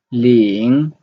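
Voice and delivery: Ling in Mandarin second tone, dipping then rising. Shown as a demonstration. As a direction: let Ling climb at the end like a question. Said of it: "the second tone".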